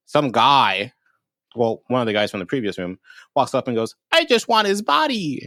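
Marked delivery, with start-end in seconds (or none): 4.1-5.4 very high, slow silly voice; put-on character voice. Guy from previous room asks for the body, high slow mock voice. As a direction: imitate the odd guy in a high drawl. Retold demand of arriving guy.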